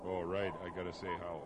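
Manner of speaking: ordinary talk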